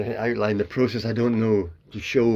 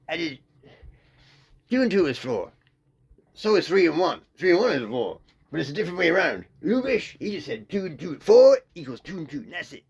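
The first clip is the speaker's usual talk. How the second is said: lightly voiced Billy Wilder, higher and punchy; Billy Wilder impression; 7 semitones higher, 5 dB louder than usual. Mocking the director's interview explanation, a light impression. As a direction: mimic the old director explaining his craft, emphatic. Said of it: "But he didn't speak like that at all".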